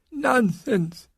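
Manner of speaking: very high, aged nun voice; croaking old nun. A narrator's reading. Mother Superior defying her interrogator, high croak. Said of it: "she croaked".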